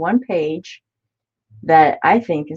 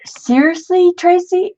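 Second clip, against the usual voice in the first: excited; 13 semitones higher, 5 dB louder than usual. Real, not acted. Excited at kit news.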